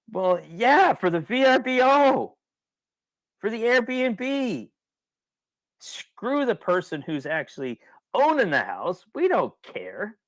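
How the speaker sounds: high, slow sneering mock of the big rental sites; mock company voice. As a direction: play the smug company that shrugs off owners.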